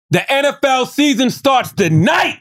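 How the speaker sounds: big high announcer hype. Real, not acted. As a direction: belt it like a stadium announcer. Hyping his team.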